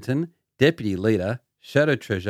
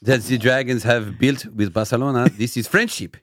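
put-on French rugby expert; hammed-up French accent, proud and emphatic. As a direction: read it in a thick comic French accent. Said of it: "you know an accent's coming".

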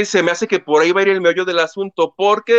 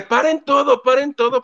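mock urgent cry; 7 semitones higher than usual.

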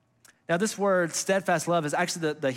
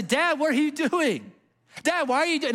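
put-on much higher, slowed child's voice asking his dad; child's plea; 11 semitones higher than usual. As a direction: plead up high like a confused second grader. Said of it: "I would say".

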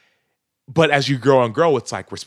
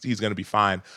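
ordinary delivery

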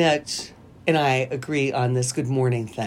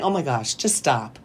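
normal talking voice

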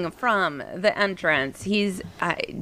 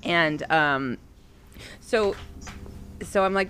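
ordinary delivery

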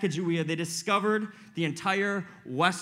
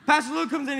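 ordinary talk

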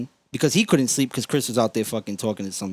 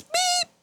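very high mechanical beep; imitated beep. Mimicking the noise as work lights come on, high beep. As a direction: squeal out a machine beep.

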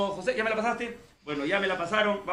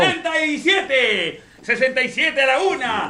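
auctioneer call; 5 semitones higher, 8 dB louder, slower than usual. Pretend. Mock auction countdown.

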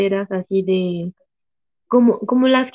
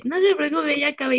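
higher mock-innocent lilt; carefree mom; 6 semitones higher than usual. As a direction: say it lightly like nothing happened. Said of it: "Y le dice".

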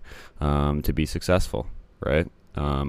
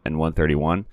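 ordinary delivery

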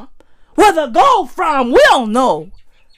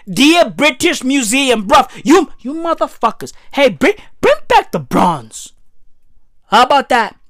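ordinary delivery

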